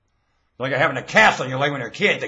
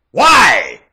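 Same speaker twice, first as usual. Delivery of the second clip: high, loud single cry; sudden shouted challenge; shouted demand; 6 semitones higher, 14 dB louder than usual. Real, not acted. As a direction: fire the word out loudly.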